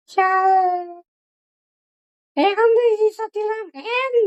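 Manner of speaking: put-on high, slow little child voice; small puppet voice. Shown as a demonstration. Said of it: "suara boneka". (suara kecil boneka anak)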